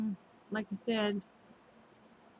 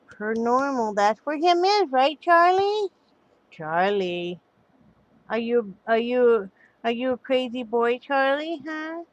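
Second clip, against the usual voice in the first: talking to a dog; 4 semitones higher, 5 dB louder than usual. Real, not acted. Showing pets, baby talk.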